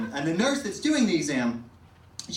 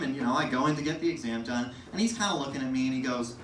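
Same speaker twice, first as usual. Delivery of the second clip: ordinary talk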